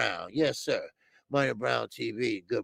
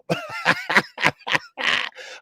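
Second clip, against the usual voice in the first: high-pitched burst of laughter; laughing at own joke; real laughter. Real, not acted. Laugh after switching subjects joke.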